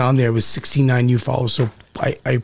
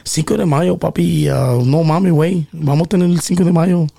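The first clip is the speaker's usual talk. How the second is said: higher Mexican Spanish monologue; Mexican accent; 4 semitones higher than usual. Shown as a demonstration. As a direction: slide into a Mexican persona. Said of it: "That was Mexican".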